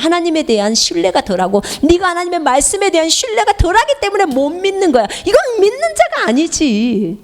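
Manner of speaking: usual speaking voice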